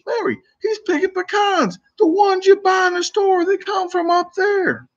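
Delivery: lady telling her friend pecans grow on trees, in a very high voice; high-pitched older lady. Retold or imitated. Older lady explaining to Mary in a high voice. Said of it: "I sounded kinda Irish at the end".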